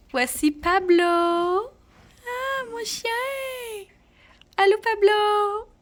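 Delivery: high, loud excited greeting; talking to a dog. Real, not acted. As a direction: gush over the puppy. Introducing and greeting her dog.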